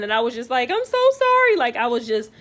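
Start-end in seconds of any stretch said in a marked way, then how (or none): 0.7-1.6 high, loud, frantic cry of sorry; imitating her own distressed plea; panicked apology. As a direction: beg forgiveness in a panic. Panicked apology after birth.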